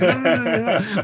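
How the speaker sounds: high-pitched mock panic, laughing; mock protest. Cornered newcomer denies being American, laughing protest. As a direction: squeal out hammed-up denials into a laugh.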